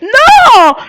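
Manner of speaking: high, loud, drawn-out no; sudden high-pitched yell of refusal; protesting shout. Real, not acted. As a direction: cry out in protest. Protests being cut off in game.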